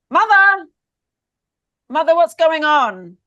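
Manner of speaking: high, loud, rising calls for his mother; confused calling out. Acted. Eros wakes in his mother's halls, calling for her loudly.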